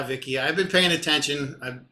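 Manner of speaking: ordinary talk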